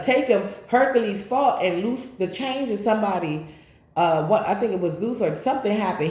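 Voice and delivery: ordinary talk, nothing marked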